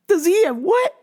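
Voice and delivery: high-pitched incredulous question; mock bafflement. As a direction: act baffled, voice shooting up. Acting out disbelief at question.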